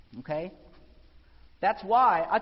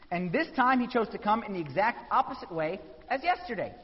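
normal talking voice

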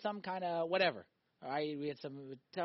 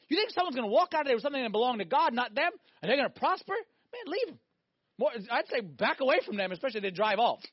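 fervent preaching; 6 semitones higher, 7 dB louder than usual. Acted. Preaching at full force.

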